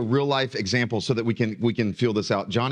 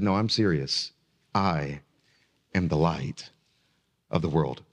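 doubling down; 10 semitones lower, 3 dB quieter than usual. Retold or imitated. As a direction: insist on it without a flicker. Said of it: "if he doubled down and he said".

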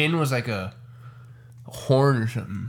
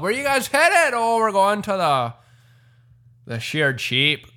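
higher, lively mock Irish pub chatter; mock Irish accent; 7 semitones higher than usual. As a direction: play a cheery Irish local at the pub. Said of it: "an Irish accent like that".

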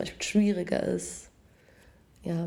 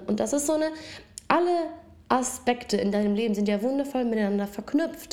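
ordinary delivery

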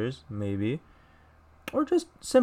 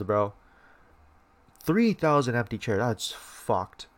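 ordinary talk

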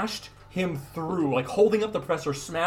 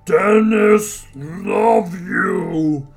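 monster plea; 2 semitones higher, 6 dB louder, much slower than usual. Acted. Monster pleads.

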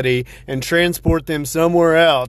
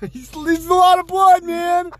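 mock panic; 5 dB louder than usual.

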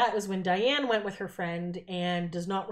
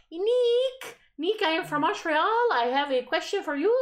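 put-on squealing, much higher girly voice; high excited fan voice; 8 semitones higher, 4 dB louder, slower than usual. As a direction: gush like a starstruck fan. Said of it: "this girl's like".

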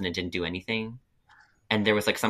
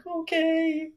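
much higher, tiny disappointed okay; pitiful little voice. Retold or imitated. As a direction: sound small and let down. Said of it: "And I was like".

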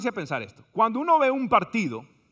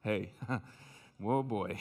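put-on low, soft, laughing "oh boy"; quiet mock dismay; mock dismayed mutter; 11 semitones lower, 10 dB quieter, much slower than usual. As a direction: play the baffled gringo under his breath. Anglo brothers staring, mocked.

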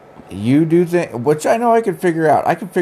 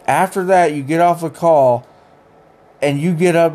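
ordinary delivery

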